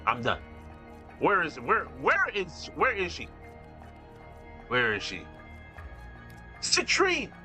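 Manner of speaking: normal talking voice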